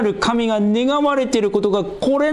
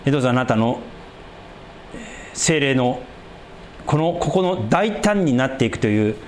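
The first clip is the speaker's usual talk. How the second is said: closing prayer; 4 semitones lower than usual. Acted.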